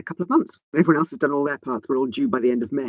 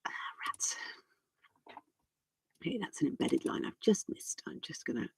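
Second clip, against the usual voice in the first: quiet muttering; 12 dB quieter than usual. Real, not acted. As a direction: mutter it under your breath. Quiet muttering while editing.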